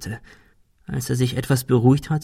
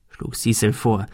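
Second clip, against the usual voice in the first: usual speaking voice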